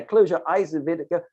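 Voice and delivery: usual speaking voice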